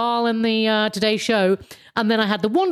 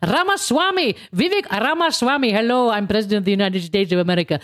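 Vivek Ramaswamy imitation. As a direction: imitate a candidate introducing himself. Mock self-introduction as Ramaswamy.

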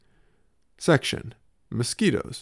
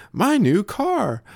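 put-on much higher, slow, louder toddler cry of joy; excited toddler; 12 semitones higher, 4 dB louder, slower than usual. Toddler showing off his new car bed, high excited toddler voice. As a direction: beam like a toddler with a new toy.

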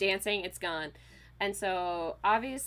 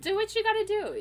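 higher and louder, cheering her on; high encouragement; 14 semitones higher, 7 dB louder, faster than usual. Retold or imitated. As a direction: pump her up, playful. Continuing to comfort her friend.

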